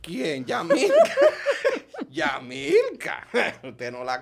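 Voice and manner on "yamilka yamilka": high, loud laughing tease. Real, not acted. Teasing friend Yamilka, laughing high and loud. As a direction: call her name while cracking up.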